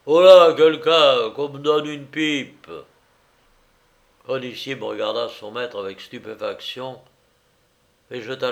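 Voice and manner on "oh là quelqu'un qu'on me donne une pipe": loud, slow call to the servants; tipsy master demanding service; booming drunken order. A narrator's reading. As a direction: call out grandly, dragging the words. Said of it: "d'une voix de basse".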